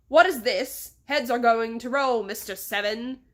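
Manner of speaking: put-on loud English-accented outburst, easing on the name; fuming. Boss threatens Mister Seven on looking around, fuming. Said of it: "the man fumed".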